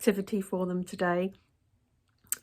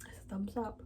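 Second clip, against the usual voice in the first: whispered through a mouthful of cookie; hushed verdict while chewing; mouth full; 11 dB quieter than usual. Real, not acted. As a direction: talk around a bite, under your breath. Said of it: "speaking with my mouth full".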